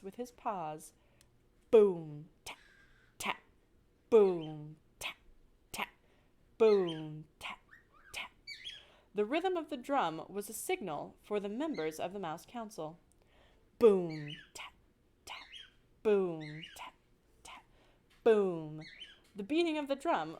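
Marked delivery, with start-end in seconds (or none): 1.7-8.3 low, loud boom then light taps; beating the council drum; drum beats. Acted. Narrator imitates the drum. 13.8-18.8 low, loud boom then light taps; beating the council drum; drum beats. Acted. Narrator imitates the drum.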